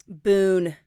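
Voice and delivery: Boone in a loud, stretched Southern twang; Southern drawn-out vowel. Real, not acted. Telling a friend the puppy's name, Southern drawl. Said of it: "elongating the vowel or saying it in the Southern accent".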